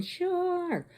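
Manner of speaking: high, drawn-out scream without full volume; held-back, high-pitched alarm cry; mock screaming. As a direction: imitate panicked swimmers screaming. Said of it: "They start screaming".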